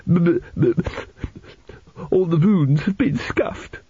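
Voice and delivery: high, stammering tearful wail; sobbing boy. A narrator's reading. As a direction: stutter it out like a small boy in tears. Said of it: "sitting on the edge of his bed and sobbing".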